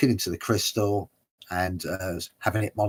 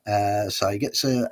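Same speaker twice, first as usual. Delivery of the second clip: ordinary talk, nothing marked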